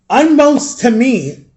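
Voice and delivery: ordinary talk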